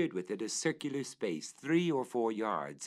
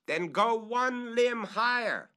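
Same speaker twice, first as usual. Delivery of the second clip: much higher, louder, slow order; calling up; 13 semitones higher, 6 dB louder, slower than usual. Acted. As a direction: push him one limb further.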